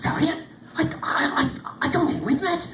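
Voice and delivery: put-on high, stammering young man; flustered stammer. Dermot stammering at the lady who claims to know him, high. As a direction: trip over the words, caught off guard.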